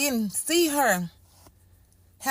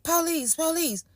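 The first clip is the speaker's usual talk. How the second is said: officers calling out; 6 semitones higher than usual. Retold or imitated. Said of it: "I'm hearing".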